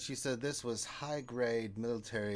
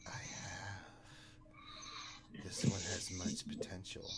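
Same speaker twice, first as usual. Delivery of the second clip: soft, breathy ghost whisper; the spirit hisses its claim; 2 semitones lower, 7 dB quieter, slower than usual. Acted. Sith spirit answers the master in a whisper. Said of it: "the other one's like".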